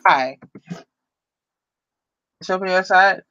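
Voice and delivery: usual speaking voice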